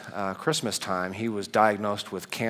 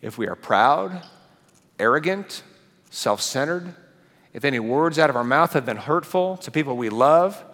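raised, rising preacher's voice building to a peak; preaching at full force; 6 semitones higher, 4 dB louder than usual. Acted. Pastor preaching God's image to the congregation, full force.

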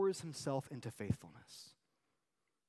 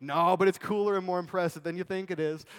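loud, higher-pitched mock bragging; boastful insistence; 5 semitones higher, 10 dB louder than usual. Youth pastor puffing up his job, boastful insistence. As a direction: insist hard on how impressive it is.

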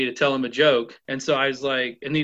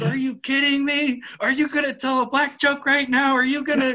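high, incredulous retold tirade; high-pitched, worked-up scolding; mock yelling; 11 semitones higher, 3 dB louder than usual. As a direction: imitate an outraged chief, pitch way up. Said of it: "just started yelling".

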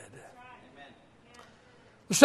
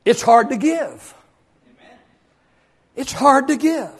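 normal talking voice

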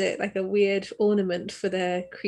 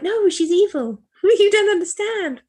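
exasperated protest; 10 semitones higher, 7 dB louder, slower than usual. Retold or imitated. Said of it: "And I'm like".

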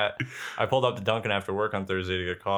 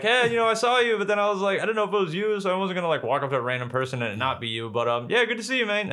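higher, eager friendly chatter; 9 semitones higher than usual. Retold or imitated. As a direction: sound overly nice and nervous. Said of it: "And I was like".

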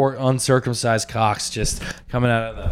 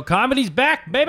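excited; 13 semitones higher than usual. Real, not acted. Cheering comedy's return.

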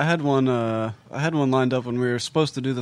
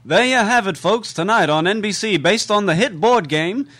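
higher, louder promo announcer; 7 semitones higher, 6 dB louder than usual. Acted. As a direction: hype it like a network promo.

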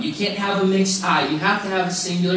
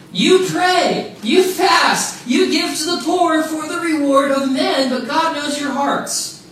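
high, loud rebuke of the Pharisees; a preacher voicing Jesus's angry rebuke, loud and high; stern rebuke; 8 semitones higher, 4 dB louder, slower than usual. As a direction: thunder it down at the hypocrites.

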